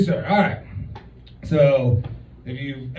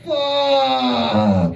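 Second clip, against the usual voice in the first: pained groan; 9 semitones higher than usual. Retold or imitated. Son doubles over in pain.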